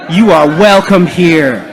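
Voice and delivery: loud, heartfelt call to the room; emphatic, like meaning it. Shown as a demonstration. Leader urging a congregation to tell each other, emphatically. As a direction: say it loud, like you mean it. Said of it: "Say it like you mean it".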